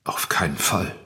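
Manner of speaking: a tense whisper; whispered denial. Acted. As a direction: breathe the denial out. Suspect denies under questioning.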